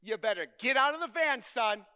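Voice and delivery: a loud, firm mom calling from outside; retold mom's call. Mom calling son from the church lot, loud and firm. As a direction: mom ordering her son out of the van, called out loud.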